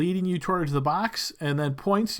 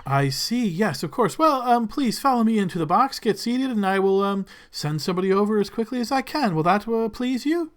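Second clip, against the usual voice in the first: fawning servant; 5 semitones higher than usual. Acted. Servant fawns over Lord Blixel, high and eager. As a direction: gush over the lord's name.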